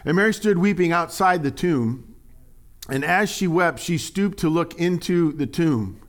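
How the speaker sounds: lifted reading voice; scripture reading. Acted. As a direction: read the verse out to the church. Reads scripture aloud.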